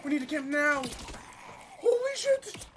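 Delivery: being chased. Real, not acted. Ambushed, panicking in a fight.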